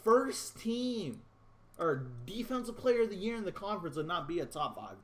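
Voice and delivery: high-pitched indignant protest; exasperated outburst. Real, not acted. Gamer protesting the first team snub, high-pitched and indignant. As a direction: burst out in disbelief at the nonsense. Ranting again at game awards.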